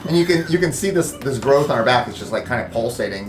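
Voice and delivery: ordinary delivery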